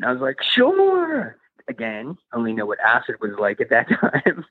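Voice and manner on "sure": a bright, high, eager yes; eager sure. Retold or imitated. Offered cocaine, answering with a high eager yes. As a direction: jump at the offer, high and keen.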